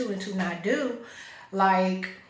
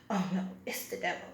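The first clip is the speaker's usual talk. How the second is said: hushed imitation of dread; quiet, mocking "scared" quote; mock horror; 8 dB quieter than usual. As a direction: mimic her alarm in a soft undertone. Imitating woman's horrified reaction.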